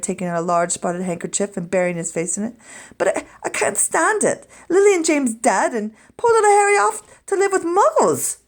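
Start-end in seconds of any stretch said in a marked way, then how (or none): 3.0-8.4 crying. A narrator's reading. Hagrid weeping over baby Harry.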